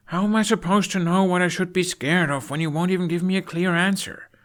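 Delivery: put-on high, loud, exasperated teen; frustrated Hiccup. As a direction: throw the question back at him.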